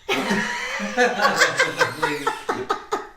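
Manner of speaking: a voiceless wheeze of laughter; breathy silent laugh. Real, not acted. After the food story, wheezing laugh.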